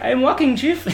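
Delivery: mild mock recruit reply; innocent recruit. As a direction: answer innocently, unaware. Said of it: "he's like".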